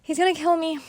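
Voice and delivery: loud, high, distressed retelling; retold sobbing. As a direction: relive the panic, voice pushed high. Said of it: "I started sobbing and telling him".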